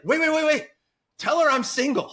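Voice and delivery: high-pitched, eager mock interruption; urgent retold interjection. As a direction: butt in excitedly, breaking toward laughter. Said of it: "I was like".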